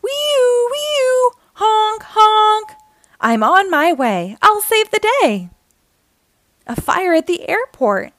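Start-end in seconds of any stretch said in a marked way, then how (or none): 0.0-2.7 high, sustained siren wail, then honks; imitated siren and horn. A narrator's reading. Fire truck races off to a fire, loud siren imitation. 3.2-5.5 loud, rising to a high finish; eager hero call. A narrator's reading. Fire truck heads out heroically.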